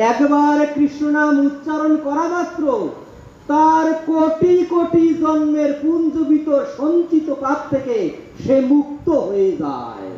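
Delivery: fervent preaching. Acted. Preacher proclaiming scripture to the congregation, fervent. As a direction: build the promise, then land it.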